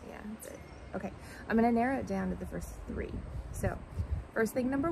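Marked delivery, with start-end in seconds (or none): none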